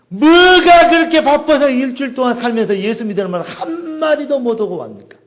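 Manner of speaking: high-pitched, forceful preacher's rebuke; scolding exhortation. Real, not acted. 설교 중 청중을 다그침. (높은 목소리로 다그치는 설교조)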